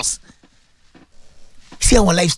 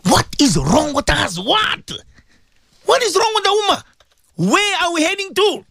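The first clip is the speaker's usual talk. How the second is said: anguished preaching outcry; 10 semitones higher than usual. Acted. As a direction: cry out to the congregation in dismay. Anguished appeal to the congregation.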